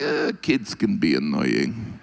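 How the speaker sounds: low, slow mock Russian shrug; shrugging deadpan. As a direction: brush it off like it's nothing. Russians voiced, shrugging excuse.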